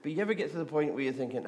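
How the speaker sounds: ordinary talk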